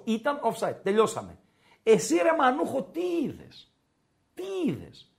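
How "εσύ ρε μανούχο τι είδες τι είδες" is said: mock incredulous questioning. Sports pundit grilling an absent referee over his call, mock incredulous. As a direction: challenge the referee in a high, incredulous voice.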